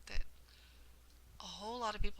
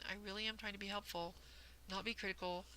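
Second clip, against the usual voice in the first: normal talking voice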